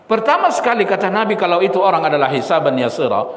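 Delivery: ordinary talk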